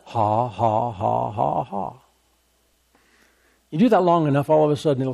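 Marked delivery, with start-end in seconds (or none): 0.0-1.9 low, soft, monotone ha-ha-ha; forced laugh. Retold or imitated. Preacher showing how he laughed without feeling like it, imitated forced laugh. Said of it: "Not because he felt like it. He just laughed".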